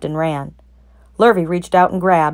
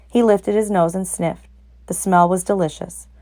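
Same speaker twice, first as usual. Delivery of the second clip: plain reading voice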